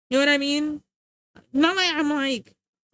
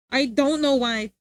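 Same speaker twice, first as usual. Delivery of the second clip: usual speaking voice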